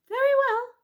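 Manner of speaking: high, loud tricky mouse. Acted. Mouse tricking the bees, high and sly.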